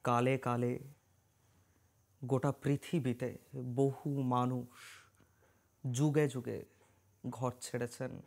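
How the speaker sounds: slow, measured, ceremonial narration; solemn story prologue. Acted. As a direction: intone it slowly, with long pauses between phrases. Solemn scripted opening of the story.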